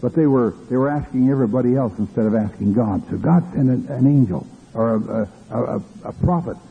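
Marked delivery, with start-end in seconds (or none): none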